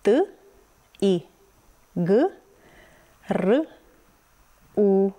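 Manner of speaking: T, I, G, R, U as isolated sounds with pauses; each sound of T, I, G, R, U on its own. Shown as a demonstration. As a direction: sound out T, I, G, R, U, consonants with a short uh. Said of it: "Să vedem sunetele".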